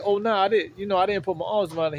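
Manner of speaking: protesting denial. Retold or imitated.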